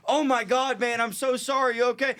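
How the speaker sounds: high-pitched, flustered mock apology; panicked apology. Driver to the man he hit, panicked apology. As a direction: act out an alarmed apology.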